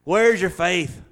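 Jesus scolding. Retold or imitated. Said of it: "he's kinda scolded him".

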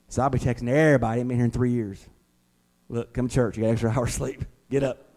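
ordinary talk

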